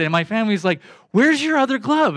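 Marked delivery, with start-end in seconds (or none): 1.1-2.2 high, incredulous family question; mock-baffled relatives; family asking, incredulous. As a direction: play the baffled family pushing him. Family teasing him about glove.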